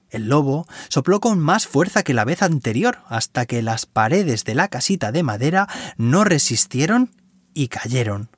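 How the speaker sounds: usual speaking voice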